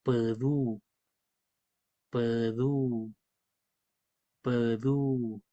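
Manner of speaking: pedú repeated low and soft, vowel contrast; slow careful pedú demo. Shown as a demonstration. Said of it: "dos letras distintas, la E y la U".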